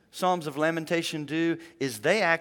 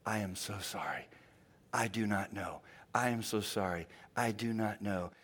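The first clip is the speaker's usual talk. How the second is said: gentle sorrow; 8 semitones lower, 10 dB quieter than usual. Retold or imitated. Pastor comforting the widow, much lower and softer. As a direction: speak with hushed helpless sympathy. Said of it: "You know what I kept saying".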